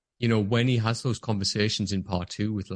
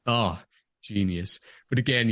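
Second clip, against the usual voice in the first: ordinary talk, nothing marked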